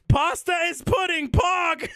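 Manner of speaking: high-pitched exclamation; mock triumphant shout. Streamer drawing an absurd conclusion for chat, mock triumphant shout.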